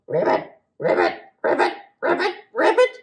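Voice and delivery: toad croak. Acted. Toad sounds in story.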